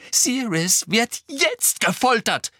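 high, slow, emphatic exclamation; agonised cry. A narrator's reading. Harry crying out for tortured Sirius, urgent. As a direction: cry out in alarm. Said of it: "rief Harry".

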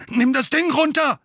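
high, loud panicked order; frightened yelp; alarmed. A narrator's reading. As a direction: cry out as the threat turns on you. Bully threatened with wand.

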